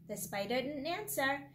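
plain reading voice

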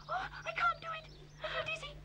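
small, soft, dizzy voice; frightened whimper. Acted. Kiki stuck up the tree, frightened whimper. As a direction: shrink back softly, scared to move.

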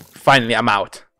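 usual speaking voice

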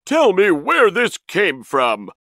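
forceful command, each word drawn out; stern order. A narrator's reading. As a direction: demand an answer from your brother. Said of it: "he ordered".

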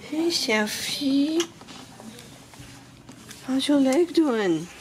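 pet talk voice. Real, not acted. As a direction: coo at the dog. Talking sweetly to her dog.

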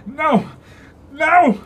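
high, loud anguished cries of no; desperate screams. Acted. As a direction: scream it out in terror. Cláudio despairs, falling into hole. (gritos desesperados)

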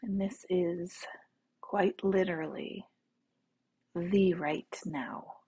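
soft, unhurried meditation voice; guided meditation. Real, not acted. Teacher leading listeners to relax in the right now, soft meditation voice. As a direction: guide them gently into the body, with long pauses.

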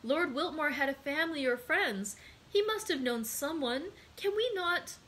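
high-voiced, anxious appeal; earnest young woman begging for hope; pleading young woman. A narrator's reading. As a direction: press the point, pitch climbing to the end. Narrator voices Julie pleading.

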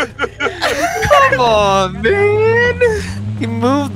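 high wailing laugh into a whine; laugh-crying. Real, not acted. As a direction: cry out while laughing. Missed shot, laughing and crying out.

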